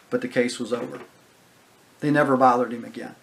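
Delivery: ordinary talk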